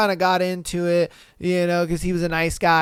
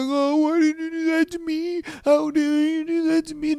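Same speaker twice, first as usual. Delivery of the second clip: much higher mocking whine of a wounded boyfriend; mock whining boyfriend; 8 semitones higher than usual. Mocking the boyfriend's whining to the viewers, much higher. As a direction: whine it like a hurt crybaby. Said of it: "he's gonna whine and he's gonna cry".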